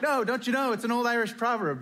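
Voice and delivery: high, eager insisting; pressing the point with glee; insistent excitement. Retold or imitated. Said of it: "So in my enthusiasm".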